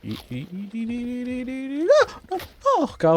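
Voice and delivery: panicked high stammer; terrified gibbering. Real, not acted. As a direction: stutter in sheer terror. Monster attack, panicked stammering.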